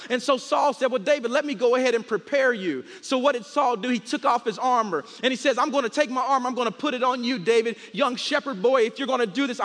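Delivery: high, loud, driving sermon delivery; rousing pulpit storytelling with acted dialogue; full-force preaching. Real, not acted. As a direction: preach the battle story to a whole room. Preaches the David and Goliath story.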